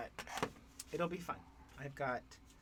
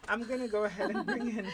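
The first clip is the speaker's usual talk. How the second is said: louder, higher and fast, laughing; facing up, laughing retake; 6 semitones higher, 7 dB louder, much faster than usual. Real, not acted. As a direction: redo it clearly with a laugh. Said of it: "Doesn't help that I'm speaking to the floor".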